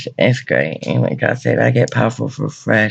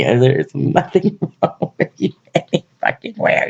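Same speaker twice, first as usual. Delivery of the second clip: higher, laughing as the words come out; 4 semitones higher than usual. Real, not acted. Gushing to the twin flame they adore, laughing. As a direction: gush through a laugh, pitch climbing.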